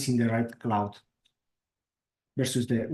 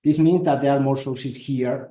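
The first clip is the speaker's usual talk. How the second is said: to someone hard of hearing; 7 dB louder than usual. Real, not acted. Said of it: "Can you speak louder".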